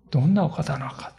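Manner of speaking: hushed, breathy realization, almost to herself; awed whisper. Acted. Healed woman whispering to herself in awe. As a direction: whisper it as if the truth just dawned. Mother-in-law realizes who Jesus is.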